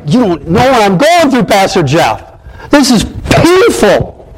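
high, aggrieved mock complaint; complaining congregant. Congregant protesting to Pastor Jeff, mock pained complaint. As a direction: act out a hurting churchgoer, stretching the last word.

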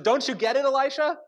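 frightened servant crying out to the prophet; panicked servant. Retold or imitated. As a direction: urge him in a panic that they're surrounded. Servant panicking at surrounding army.

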